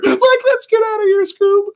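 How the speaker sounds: very high, loud panicky whine; high cracking cartoon panic; Shaggy voice. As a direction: cry out like scared Shaggy. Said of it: "Scooby-Doo".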